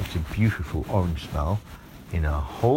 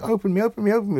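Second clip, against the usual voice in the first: high, louder chanting doors; chorus of doors; 11 semitones higher, 5 dB louder, much faster than usual. A narrator's reading. Ten doors chanting at the girl, high. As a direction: doors clamouring over each other.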